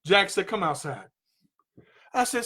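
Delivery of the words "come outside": low, hushed instruction; calming coach. Retold or imitated. Coach asking upset student to step out of the dojo, calming. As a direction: quietly draw him away.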